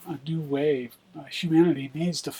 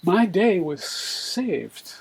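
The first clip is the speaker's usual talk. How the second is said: slow, louder poetry reading; reciting a poem; 2 semitones higher, 3 dB louder, slower than usual. Acted. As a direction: read it like a poet performing. Reads his poem aloud.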